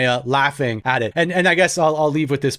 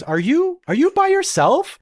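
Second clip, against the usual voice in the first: much higher, rising disbelief; surprised attendant; 13 semitones higher than usual. Retold or imitated. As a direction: ask as if shocked to see him alone. Flight attendant voiced surprised.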